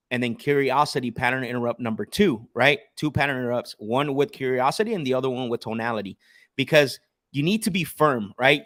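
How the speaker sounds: ordinary talk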